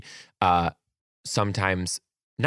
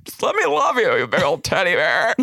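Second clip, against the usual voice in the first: very high, loud mock plea; pleading fan; 11 semitones higher, 6 dB louder than usual. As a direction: gush desperately, hammed up. Acted plea to the comedian ends.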